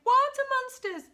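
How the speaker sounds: very high, loud storybook shriek; alarmed cry. A narrator's reading. Sister seeing monsters in the water, high loud cry. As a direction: cry out in mock terror. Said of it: "and one cried".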